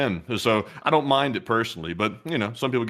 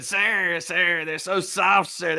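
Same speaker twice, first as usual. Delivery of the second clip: high put-on voice, slow; slow, high caricature pleading "sir"; mock old man voice; 7 semitones higher, much slower than usual. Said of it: "we do the voices".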